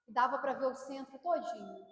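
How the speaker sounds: normal talking voice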